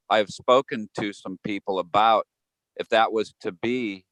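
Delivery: ordinary talk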